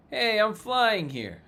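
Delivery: loud, high, slow mock New Jersey holler; New Jersey alien. Alien yelling at someone in its way, mock New Jersey accent. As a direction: call it out like an indignant New Jersey local, hammed up. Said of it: "New Jersey aliens be like".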